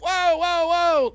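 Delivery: high, loud mock call to stop; mock calling out whoa. Judge tries to halt the shouting debaters, mock call. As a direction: call out to break it up.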